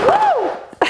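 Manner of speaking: high whoop of triumph; excited cheer. Real, not acted. Housing comes off.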